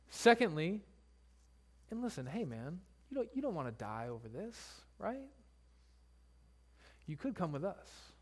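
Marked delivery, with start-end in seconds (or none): 1.9-8.1 quiet threat. Retold or imitated. Danites threaten the priest.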